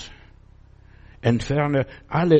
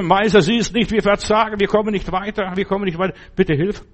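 panicked plea; 6 semitones higher, 4 dB louder than usual. Retold or imitated. Disciples pleading in the storm.

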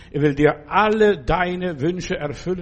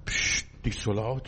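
hushing; 7 semitones lower, 7 dB quieter than usual. Retold or imitated. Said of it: "vom Teufel aufhalten".

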